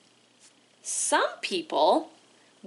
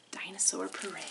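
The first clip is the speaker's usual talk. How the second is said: soft reverent whisper of the book name; whispered title; 4 semitones lower, 11 dB quieter than usual. Acted. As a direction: hush the title almost to nothing.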